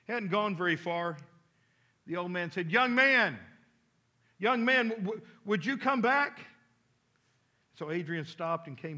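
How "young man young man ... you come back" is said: loud, raised call after someone. Retold or imitated. Old man calls the departing boy back, raised call. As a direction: call him back from down the road, higher.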